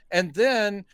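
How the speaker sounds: ordinary talk, nothing marked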